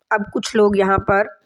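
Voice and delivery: ordinary delivery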